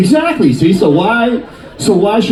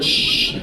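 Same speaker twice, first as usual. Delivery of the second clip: shower water shush; 7 dB quieter than usual. Retold or imitated. Imitating shower water sound.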